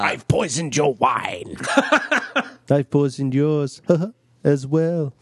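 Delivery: mock villain. Voicing villain lines.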